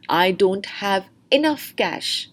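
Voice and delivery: "enough" carries the stress, slow. Shown as a demonstration. Teacher demonstrating stress for students, slowly stressing "enough". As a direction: linger and press on "enough". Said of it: "Now the stress is on enough".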